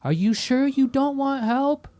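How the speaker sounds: very high, loud, sing-song mock of the manager; mocking high-pitched condescension. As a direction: talk down to an old customer, exaggerated. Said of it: "Like, really slow and, like, high pitch".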